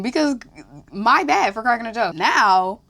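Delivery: normal talking voice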